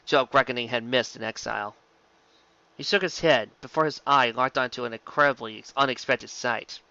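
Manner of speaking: ordinary delivery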